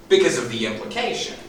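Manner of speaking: usual speaking voice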